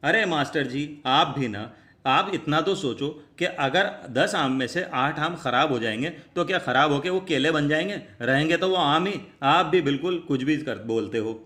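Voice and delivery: ordinary talk, nothing marked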